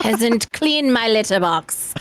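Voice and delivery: snooty, haughty cat voice. Retold or imitated. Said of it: "a more, like, snooty voice".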